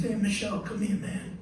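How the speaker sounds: soft high call, a hint of imitation. Bandleader calls composer over, lightly imitated. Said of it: "He goes".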